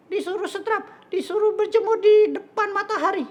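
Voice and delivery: very high squeaky puppet voice; childlike puppet. Acted. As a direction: guess eagerly in a tiny high-pitched voice. Puppet pupil chats with teacher.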